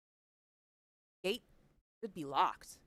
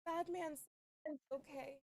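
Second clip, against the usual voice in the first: put-on scared child; 11 semitones higher, 6 dB quieter than usual. Child April whispering fearfully.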